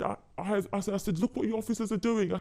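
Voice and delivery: high, agitated protest, retold without real volume; retold shouting. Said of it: "Why are you shouting at my officers for?".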